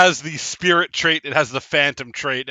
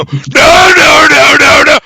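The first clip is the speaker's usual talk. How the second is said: high, loud, terrified screams of no; screaming in fear; 14 semitones higher, 14 dB louder, slower than usual. A narrator's reading. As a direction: scream no in terror. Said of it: "start screaming in fear".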